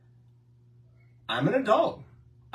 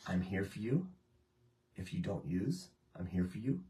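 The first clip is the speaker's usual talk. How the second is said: soft, low reassuring words; tender quiet promise; loving reassurance; 6 semitones lower, 9 dB quieter than usual. Retold or imitated. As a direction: say it gently to a loved one. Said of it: "to say".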